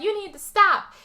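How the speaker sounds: high-pitched imitated demand; mock urgent plea. Daughter stopping her father axing someone, acted urgency.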